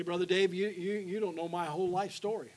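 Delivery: loud, pleading objection from the pew; voicing a doubting listener. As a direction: call out the doubt like a skeptic in the crowd. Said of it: "You say".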